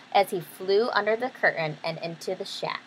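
ordinary delivery

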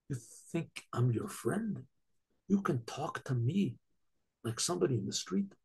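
hushed and deliberate, like an offended king; quiet menace. Acted. Angered king confronts subject.

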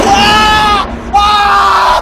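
sudden high scream; startled scream. Real, not acted. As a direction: shriek in fright. Jump scare in horror game.

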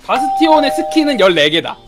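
usual speaking voice

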